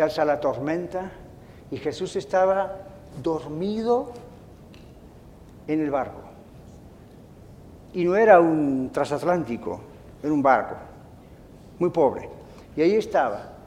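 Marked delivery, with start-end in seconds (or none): none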